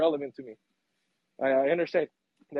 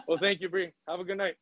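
ordinary talk

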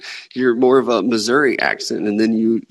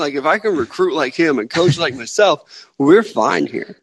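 higher-pitched Southern accent in imitation; mock Southern coach; 7 semitones higher than usual. Co-host imitating coach on recruiting down here, mock Southern accent. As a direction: play the new coach putting on a Southern accent. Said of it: "This dude looked at Coach O and said".

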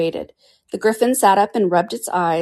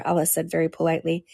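plain reading voice

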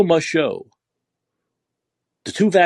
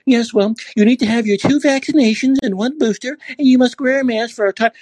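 mock opera receptionist; 7 semitones higher than usual. Host mimics the opera clerk answering his friend, in a higher mock voice. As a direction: play the opera clerk reciting the rules as if they were normal. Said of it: "Hey, I'll do a recreation".

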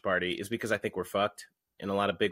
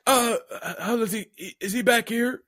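put-on higher strained old voice; generic old man; 11 semitones higher than usual. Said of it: "I'm just trying to do generic old".

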